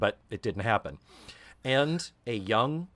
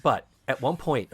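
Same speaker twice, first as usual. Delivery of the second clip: ordinary delivery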